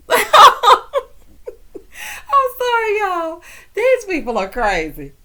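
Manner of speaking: high, loud laugh spilling into the words; cracking up, apologizing through the laughter; can barely talk for laughing; real laughing fit. Real, not acted. Cracked up by the story.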